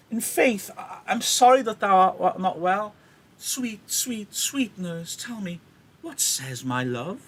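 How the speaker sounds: grovel sweetly to get the news; grovelling. Acted. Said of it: "A bit of grovelling".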